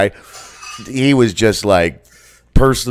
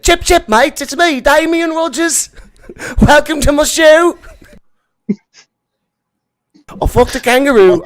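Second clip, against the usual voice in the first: much higher, louder hammed-up Aussie kid voice; high young Australian voice; 4 dB louder than usual. Imitating a friend's teenage podcast intro in a squeaky high Australian accent. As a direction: squeak it out like a cocky Aussie teen. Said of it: "with a much higher pitched voice 'cause he was young".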